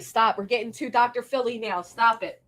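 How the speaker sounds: usual speaking voice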